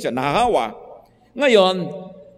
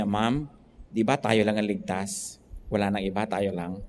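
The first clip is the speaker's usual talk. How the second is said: hushed retold quote; 9 semitones lower, 8 dB quieter than usual. Preacher retells the beautician's words to a client, dropping to a soft hush. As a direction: voice dropped soft, almost confiding. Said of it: "sabi nitong, ah, nagfe-facial sa kanya".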